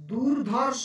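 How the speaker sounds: ordinary talk, nothing marked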